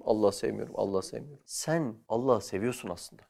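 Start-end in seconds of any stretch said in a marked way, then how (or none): 0.0-1.4 imitating a stubborn denial, fading softer; sulky quiet repeat. Teacher mimics a student who claims not to love God, fading sulkily. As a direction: mutter it again and again, dying away like a pouting child. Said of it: "Bir tanesi diyor işte".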